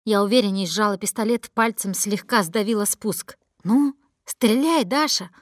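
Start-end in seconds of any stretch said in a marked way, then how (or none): 3.6-5.3 slow, taunting dare, higher and louder; mocking. A narrator's reading. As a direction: goad her to shoot. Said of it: "насмешливо сказал Руслан". (Руслан насмешливо дразнит Дашу)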